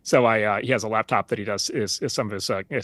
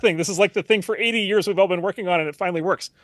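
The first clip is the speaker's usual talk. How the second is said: higher, louder, retelling his own amazed protest; incredulous excited dad, lightly replayed; excited disbelief; 7 semitones higher, 4 dB louder than usual. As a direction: pitch it up like a dad who can't believe his kid shrugged. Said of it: "And I was like".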